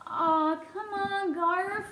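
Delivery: high, drawn-out wheedle; coaxing. A narrator's reading. Mouse begs the boy to relent, coaxing. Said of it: "coaxed Ralph".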